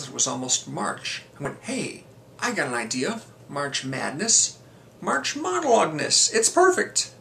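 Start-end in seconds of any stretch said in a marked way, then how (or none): 1.6-7.1 high-pitched mock excitement, drawing out the name; excited brainwave. Vlogger replaying his sudden idea for a series, excited. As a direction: act out a eureka moment, pitch leaping up.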